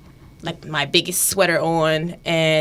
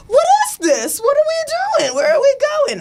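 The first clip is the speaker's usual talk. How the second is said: much higher, louder frantic questions; panicked boyfriend; 5 dB louder than usual. Retold or imitated. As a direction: squeal in mock panic. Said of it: "he was like".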